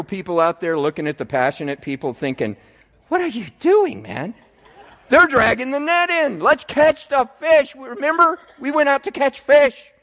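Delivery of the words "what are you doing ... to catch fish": exasperated practical disciple. Retold or imitated. Practical disciple scolds Peter.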